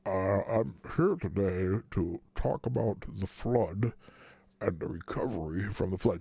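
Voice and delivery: put-on low, slow, muffled old senator drawl; McConnell impression. Host quoting McConnell's flood answer, low slow mimicry. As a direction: drag it out from deep in the chin. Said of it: "he quickly responded, quote".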